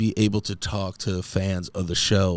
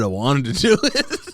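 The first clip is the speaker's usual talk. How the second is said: laughing; 5 semitones higher than usual. Real, not acted. Laughs at own wording.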